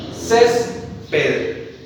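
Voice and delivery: "césped" drawn out and falling, weight on CES; stressed syllable demo. Shown as a demonstration. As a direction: lean on the first syllable of "césped". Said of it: "veamos acá". ("césped" con fuerza en la primera sílaba)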